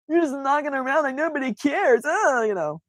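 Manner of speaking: high theatrical whine; drama queen. Pretend. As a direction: keep whining dramatically. Continuing drama-queen parody.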